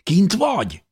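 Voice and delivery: high, excited call; eager question. A narrator's reading. Dávid calling up from below, excited. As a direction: ask it breathlessly, hoping for yes.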